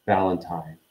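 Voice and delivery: ordinary delivery, nothing marked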